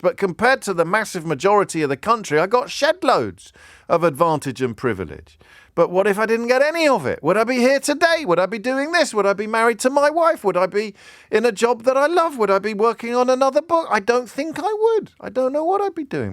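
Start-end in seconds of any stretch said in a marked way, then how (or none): 5.7-16.3 higher and higher, pressing each question; impassioned self-questioning, voice raised high; building intensity. Real, not acted. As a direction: stack the questions, pitch climbing. Rising run of rhetorical questions.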